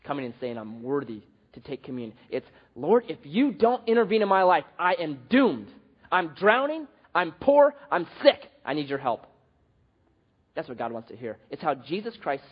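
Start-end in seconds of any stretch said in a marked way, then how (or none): 0.6-2.2 quiet, lower smug boast; proud. Retold or imitated. Preacher voicing a proud churchgoer, quietly smug. 2.7-9.3 desperate plea. Retold or imitated. Voicing a desperate prayer.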